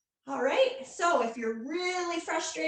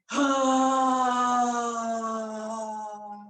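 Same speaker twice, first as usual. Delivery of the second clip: long low breathy exhale through an open mouth; deep hippo breath; 4 semitones lower than usual. Shown as a demonstration. Yoga teacher showing kids the hippo breath, deep and low. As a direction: let out a big hippo sigh from deep down. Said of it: "really deep, low sound".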